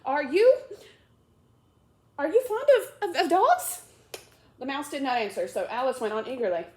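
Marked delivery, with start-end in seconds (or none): 0.0-3.8 high, loud, rushed question; hurried eager question. Acted. Alice hurrying to change the subject, eager and loud. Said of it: "in a great hurry to change".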